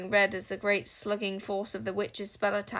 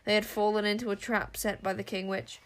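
ordinary delivery

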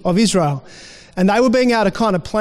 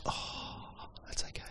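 soft whisper off to the side; whispered aside; 26 dB quieter than usual. Real, not acted. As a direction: whisper it so only one person hears. Quiet aside during the sermon.

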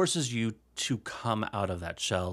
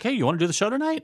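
Dick; 11 semitones higher, 3 dB louder, much faster than usual. Retold or imitated. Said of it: "Dick was like".

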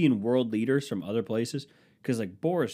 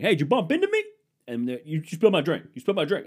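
mock bar fight; 7 semitones higher, 6 dB louder, faster than usual.

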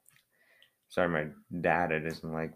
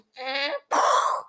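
creaking door noise; 5 dB louder than usual. Shown as a demonstration. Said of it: "it goes".

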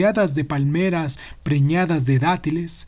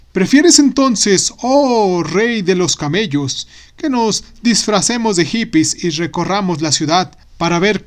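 scheming vizier; 2 semitones higher, 3 dB louder than usual. Acted. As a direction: coax the king with flourish. Vizier proposes disguise.

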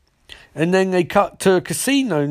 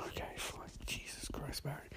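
whispered, fed-up sigh of words; exasperated whisper; 26 dB quieter than usual. Retold or imitated. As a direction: breathe it out under your breath. Harrison exasperated under breath.

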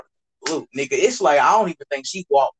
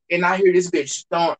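ordinary talk, nothing marked

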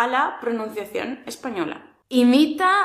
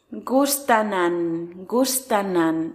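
"Gustanan" said slowly and lower; slow lower drill; 5 semitones lower, slower than usual. Shown as a demonstration. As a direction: repeat "gustanan" slowly as a warm-up. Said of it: "me gustan antiguos".

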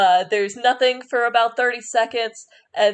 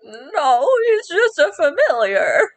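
put-on old woman voice; 7 semitones higher, slower than usual. Old woman correcting druid.

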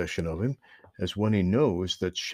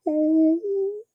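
high, loud squeal of fright; sudden high frightened yelp; frightened cry; 4 dB louder than usual. Acted. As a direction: cry out like a terrified squirrel. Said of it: "cried Chatter".

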